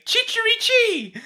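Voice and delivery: high, drawn-out cock-crow; Italian rooster crow. Shown as a demonstration. Showing friends how Italian roosters sound, high crowing. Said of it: "Italians say that roosters say".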